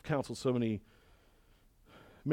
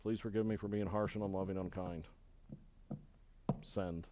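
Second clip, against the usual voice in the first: much softer, lower sheepish apology; sheepish mumble; 9 semitones lower, 17 dB quieter than usual. Retold or imitated. Preacher acting a sheepish apology email, soft and low. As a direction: mumble the apology like a scolded kid. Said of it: "you're like".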